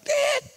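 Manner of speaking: high-pitched imitated bleep; censor beep. Preacher bleeping out a worldly person's swearing, high censor beep. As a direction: squeak out a sustained censor beep. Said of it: "Terlalu banyak sensor".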